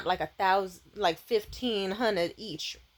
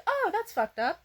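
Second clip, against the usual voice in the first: high, worried voice; high alarmed; 12 semitones higher, 4 dB louder than usual. Retold or imitated. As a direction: sound shocked and confused. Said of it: "I'm like".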